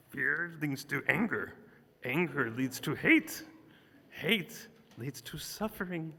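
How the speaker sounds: a light Yoda impression, wise little alien master. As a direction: recite it as the old Jedi master would. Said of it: "I'm gonna do it in a Yoda voice".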